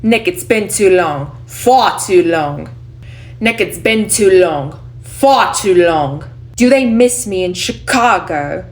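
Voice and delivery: put-on loud, projected New York accent; stage New York accent, stretching "far too long". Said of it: "talking with the New York accent".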